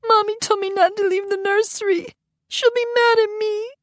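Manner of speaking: put-on very high small boy voice; upset child. Little boy fearing his mother, upset. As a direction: fret like a scared toddler.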